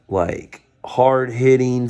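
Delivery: normal talking voice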